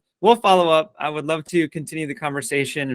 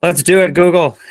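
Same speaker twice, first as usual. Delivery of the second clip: laughing cheer; 4 semitones higher, 8 dB louder than usual. Real, not acted. Excited laughing agreement.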